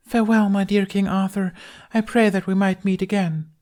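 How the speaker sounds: high, theatrical Guinevere farewell; a high, lofty queen bidding farewell. Acted. Tooth plays Guinevere.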